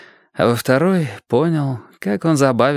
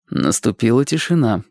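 ordinary delivery

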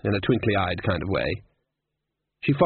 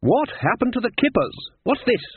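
raised, much higher outraged wizard; indignant; 13 semitones higher than usual. Acted. Wizard demanding why the kippers are gone, outraged. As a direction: demand an answer for the missing kippers.

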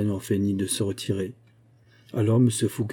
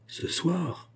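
"ce soir" high and hushed, as a shocked question; alarmed echo; 9 semitones higher, 4 dB quieter than usual. A narrator's reading. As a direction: echo "ce soir" as if the blood drains away. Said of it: "s'écria Fouquet en pâlissant".

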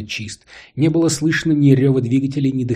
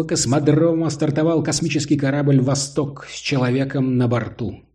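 booming solemn state announcer; 4 semitones higher than usual. Acted. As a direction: proclaim it like a state broadcast. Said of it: "А над землёй разносился голос, вещающий о новом витке".

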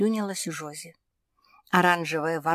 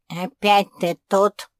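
loud, slow, hoarse grumble; hoarse croak; 4 semitones higher, 10 dB louder than usual. A narrator's reading. Cat Кузьма greeting an unwelcome visitor, hoarse croak. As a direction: croak it out loudly and slowly. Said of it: "прохрипел Кузьма".

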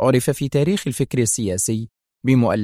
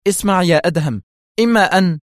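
raised, higher-pitched ultimatum, cut short; warning cry; 6 semitones higher, 5 dB louder than usual. A narrator's reading. Woman begins an ultimatum to Adham, loud cry cut off. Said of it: "وهي تلوح بسبابتها في وجهه هاتفة".

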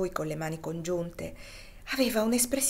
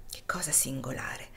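lower, softer, half-whispered; quiet wonder; 6 semitones lower, 6 dB quieter than usual. A narrator's reading. St. Clare turning aside, marvelling quietly to himself. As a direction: murmur it, turning away. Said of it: "esclamò Agostino voltandosi da un lato".

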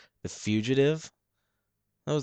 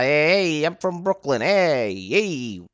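loud, high hammed-up Brooklyn tough guy; Brooklyn accent; 9 semitones higher, 9 dB louder than usual. Podcaster showing off to listeners in a loud Brooklyn accent. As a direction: ham up a Brooklyn wise guy, big and loud. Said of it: "there's another little Brooklyn accent".

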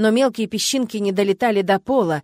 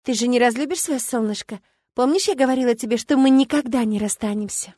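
pleading sweetly; 4 semitones higher than usual. Acted. Sun pleads, clings to Petr.

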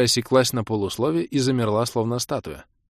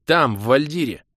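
louder, exasperated retort; cross, with raised voice; irritated; 5 semitones higher, 5 dB louder than usual. A narrator's reading. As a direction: protest as if it's obvious. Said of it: "озлился я".

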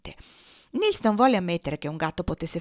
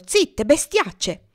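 high, loud, drawn-out scolding; angry cry; 7 semitones higher, 5 dB louder, much slower than usual. A narrator's reading. Boy throws a stone at the hens, angry yell. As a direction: yell at them to shut up.

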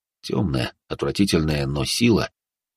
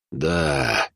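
grim; 5 semitones lower, 3 dB quieter than usual. Acted. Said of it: "мрачно ответил".

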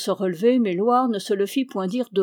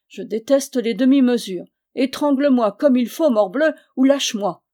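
louder, higher, exasperated protest; indignant demand; 4 semitones higher, 7 dB louder than usual. A narrator's reading. Pinned knight dares his cousin to finish or let go, loud indignant demand. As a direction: protest loudly, pitching it up.

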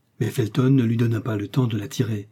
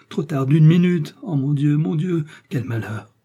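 higher-pitched lament, loud then subsiding; despairing exclamation; 4 semitones higher, 4 dB louder than usual. A narrator's reading. Lord de Winter laments arriving too late, despairing exclamation. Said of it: "s'écriait-il".

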